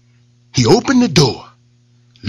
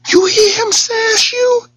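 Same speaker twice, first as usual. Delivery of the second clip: goading rabbit. Acted. Rabbit goads lion.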